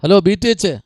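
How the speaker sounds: loud hail for attention; calling out. Real, not acted. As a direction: call the group to respond. Hails the group louder after silence.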